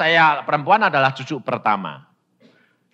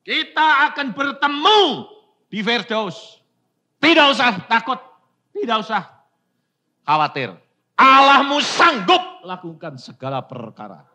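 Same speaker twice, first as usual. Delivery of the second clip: higher and louder, urgent comfort; fervent reassurance; 8 semitones higher, 6 dB louder than usual. Retold or imitated. Father reassures dying daughter, fervent raised voice. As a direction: lift her up with faith.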